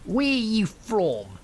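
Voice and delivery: put-on high, drawn-out drunken heckle; slow, loud slur of a pestering drunk; drunk man. A narrator's reading. Drunk man hailing a passer-by, slurred and pitched high. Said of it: "staggering a bit because he was drunk".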